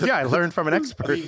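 a light Irish accent, mocking the monks. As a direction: tease with a mild Irish lilt, laughing. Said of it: "a thick Irish accent".

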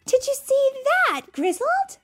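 high and loud; excited yell. Acted. Rookie yells at Sam over the stunt, high and loud. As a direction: shout it while slapping his arm. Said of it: "Rookie yelled".

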